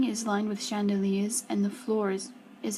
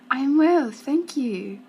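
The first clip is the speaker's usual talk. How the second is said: put-on lightly touched French accent, louder opening; 4 semitones higher, 7 dB louder, slower than usual. A narrator's reading. Missus Eiffel answering a guest, polite light French accent. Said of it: "says in her lovely French accent".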